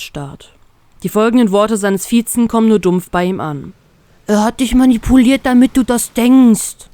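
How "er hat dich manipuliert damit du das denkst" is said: louder, pleading and desperate; begging her to see it. Acted. Beppo pleading with the girl over the snail, desperate and louder. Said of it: "antwortet Beppo verzweifelt".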